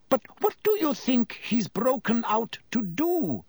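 high-pitched woman's anxious question; landlady character. A narrator's reading. Landlady asking the minister about the escape, high and anxious. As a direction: lift the voice high, worried.

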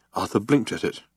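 usual speaking voice

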